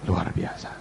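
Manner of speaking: low, breath-led echo of "luar biasa"; hushed awe. Real, not acted. Preacher closes his sermon in awe, "luar biasa" said low and hushed. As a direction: let "luar biasa" drop to a hush. Hushed final repeat after climax.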